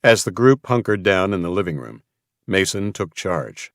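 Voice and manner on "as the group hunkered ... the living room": plain reading voice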